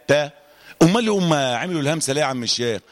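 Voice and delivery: ordinary talk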